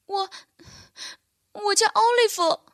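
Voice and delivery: high, halting stammer of a scared fawn. A narrator's reading. Lost reindeer fawn stammers his name to the bear, high and timid. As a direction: stumble over the first word, shy and small. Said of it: "他结结巴巴地说".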